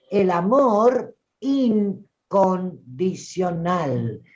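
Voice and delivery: "incondicional" stretched piece by piece for weight; emphatic enunciation. Real, not acted. Teacher driving home universal love to the group, slow emphatic enunciation. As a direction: draw out "incondicional" very slowly, breaking it into separate chunks. Teacher spells out the key concept.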